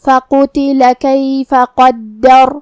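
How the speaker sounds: very slow, drawn-out melodic tajweed; Quran recitation. Shown as a demonstration. Teacher reciting the verse for students, slow melodic tajweed. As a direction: chant each word long and measured.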